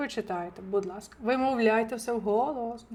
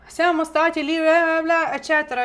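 higher, louder chatty example; lively example; 6 semitones higher, 6 dB louder than usual. Shown as a demonstration. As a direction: rattle it off like gossip.

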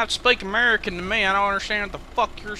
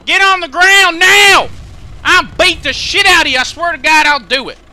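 shouting; 8 semitones higher, 10 dB louder than usual. Acted. Cop yelling at suspect.

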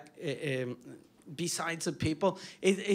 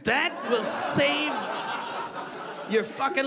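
shout; 5 semitones higher, slower than usual. Real, not acted. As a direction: hammer the punchline. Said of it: "punchline shouted".

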